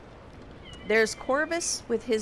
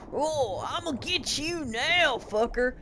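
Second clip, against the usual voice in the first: hillbilly bad guy; 3 semitones higher than usual. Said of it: "he's got that generic hillbilly".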